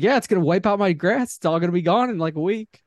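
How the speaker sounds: worked-up retelling of a complaint; retold complaint. Retelling own complaint to dad.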